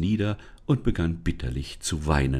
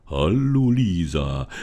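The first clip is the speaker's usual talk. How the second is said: forest spirit; 4 dB louder, slower than usual. Acted. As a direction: speak as a calm old forest spirit.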